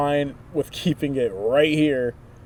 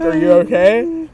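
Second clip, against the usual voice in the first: mom asking with deep concern, pitch raised; a worried mother checking on her son; mom's concern; 9 semitones higher, 12 dB louder than usual. Retold or imitated. Imitating mom asking with concern. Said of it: "she asked with, like, an attitude ... a deep concern".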